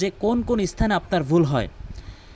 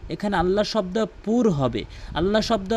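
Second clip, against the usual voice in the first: ordinary talk, nothing marked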